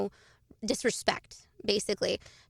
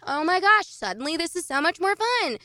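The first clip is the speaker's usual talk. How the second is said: higher, louder burst of mock revelation; delighted discovery; 6 semitones higher, 4 dB louder than usual. Tennis dropout discovers pickleball, suddenly excited. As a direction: gush as if amazed by a new game.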